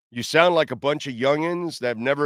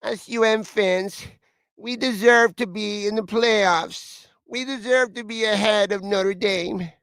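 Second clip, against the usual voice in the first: whiny voice; 6 semitones higher than usual. Acted. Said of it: "do the whiny voice again".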